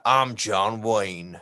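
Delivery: slow, low mock cowboy drawl; a British person's put-on John Wayne; John Wayne accent. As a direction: do a Brit doing John Wayne. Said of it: "I'm gonna do an American accent".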